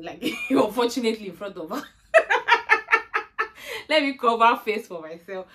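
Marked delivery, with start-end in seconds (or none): 2.1-3.8 high laugh. Real, not acted. Laughing while retelling.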